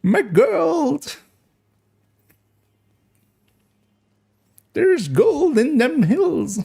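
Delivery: put-on high, slow old prospector voice. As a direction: croak it like a gold-rush prospector. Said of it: "we went with the old prospector voice".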